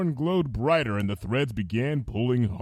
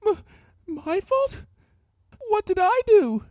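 frightened stammer; 4 dB louder than usual. Acted.

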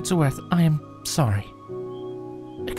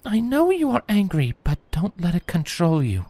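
higher, calm counsel; gentle warning; 10 semitones higher than usual. A narrator's reading. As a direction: Celestia warning grieving Twilight about anger. Celestia counselling Twilight.

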